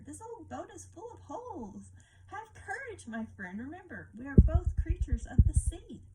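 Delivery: high, bright lobster voice, unbothered; breezy lobster laughing off danger; cheerful lobster. A narrator's reading. Lobster answering the crab calmly, high and cheerful. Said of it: "said the lobster".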